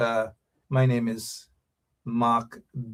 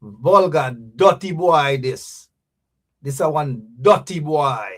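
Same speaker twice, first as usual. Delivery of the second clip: put-on Jamaican accent; 2 semitones higher, 6 dB louder than usual. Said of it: "as the Jamaicans would say".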